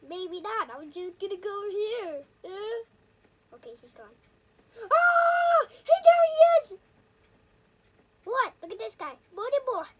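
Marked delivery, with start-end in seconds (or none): 4.8-6.8 startled shout. Real, not acted. Spotted by neighbor.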